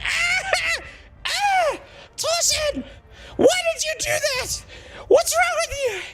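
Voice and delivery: hammed-up, very high-pitched wailing scream; mock shrieking through tears as an outraged Jane; screaming and crying. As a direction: squeal it out like someone in agony. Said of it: "she's screaming her fucking head off".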